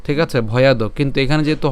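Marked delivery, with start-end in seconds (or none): 0.5-0.8 ভয়াদ ending in an open "do"; full final vowel. Shown as a demonstration. Teacher repeats the form without halant, ভয়াদ as "bhoyado". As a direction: sound the o after the d of ভয়াদ. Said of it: "হর্ষ চিহ্ন না থাকতো".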